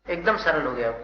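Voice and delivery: ordinary talk, nothing marked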